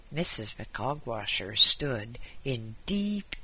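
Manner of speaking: ordinary delivery, nothing marked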